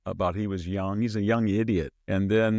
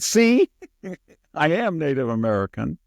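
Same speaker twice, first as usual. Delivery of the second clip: high, loud mock triumph with a laugh; mocking Warren; 6 semitones higher, 6 dB louder than usual. Mocking Elizabeth Warren's DNA claim, high and laughing. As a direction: crow it like a gotcha. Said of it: "she said".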